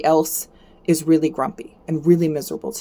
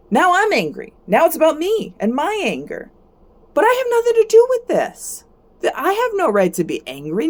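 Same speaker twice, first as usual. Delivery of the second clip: mock anger; 10 semitones higher than usual. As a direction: play at flying into a rage to show the wrong way. Acting out catching someone's anger.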